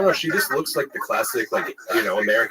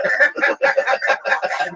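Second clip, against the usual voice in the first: very high burst of laughter; real high-pitched crack-up; big laugh; 4 dB louder than usual. Real, not acted. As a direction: cackle hard at the joke. Laughing at the acted-out joke.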